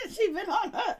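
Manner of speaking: high, laughing through the words; real laughing agreement. Real, not acted. Co-host agreeing about the chase after Karen, laughing. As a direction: laugh as you agree, voice lifted.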